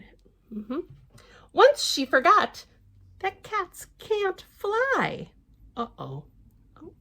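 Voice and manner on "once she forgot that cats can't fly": lively storybook reading voice; picture-book narration; reading to children. Acted. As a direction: read it out brightly to little ones. Reads the book aloud.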